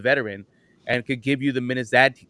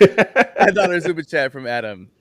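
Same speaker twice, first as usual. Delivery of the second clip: reading through real laughter, louder and slower; higher, laugh-filled announcement; 4 semitones higher, 6 dB louder, slower than usual. Real, not acted. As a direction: try to announce it while cracking up. Cracking up after joke greeting.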